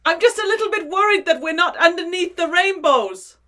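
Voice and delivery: put-on worried little leprechaun in a shaky-at-best Irish accent. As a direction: put on an amateur Irish brogue, a bit worried. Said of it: "do the accent that I can't do".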